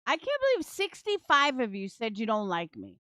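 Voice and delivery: ordinary talk, nothing marked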